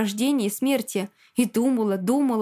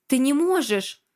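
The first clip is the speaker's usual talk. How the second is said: higher-pitched, restrained frantic cry; frantic scream; 4 semitones higher than usual. A narrator's reading. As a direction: cry out in desperate protest. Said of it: "закричала истошно".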